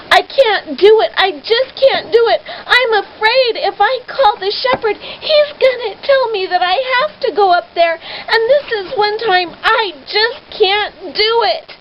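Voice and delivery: high tearful wail, near sobbing; frightened girl crying she can't do it; crying panic. Acted. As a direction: beg through tears like a scared child. Much Afraid panicking, crying.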